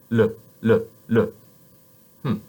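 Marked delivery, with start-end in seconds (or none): 0.1-1.3 "le" with a light tongue-tip "l"; French L. Shown as a demonstration. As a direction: clear French "le".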